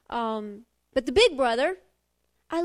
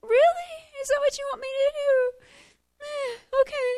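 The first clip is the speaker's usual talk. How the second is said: high mock reply; 12 semitones higher, 6 dB louder than usual.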